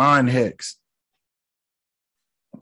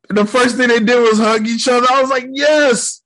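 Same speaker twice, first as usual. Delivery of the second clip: high, loud delight; thrilled, raised-voice cheer; excited cheering; 11 semitones higher, 5 dB louder than usual. Real, not acted. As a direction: celebrate loudly, pitched way up. Cheering the reunion.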